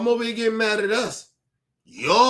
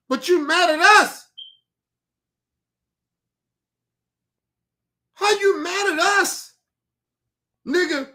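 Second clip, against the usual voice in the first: incredulous; 9 semitones higher, 3 dB louder than usual. Real, not acted. Heated rant, incredulous questions.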